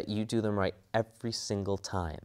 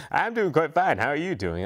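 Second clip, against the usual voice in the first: much higher, louder answering voice; the voice of Blade replying to his creator, pitched up; Blade's voice; 7 semitones higher, 4 dB louder than usual. Acted. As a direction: answer as his first character, cheerfully. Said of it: "fun conversations like, "Hey, Blade".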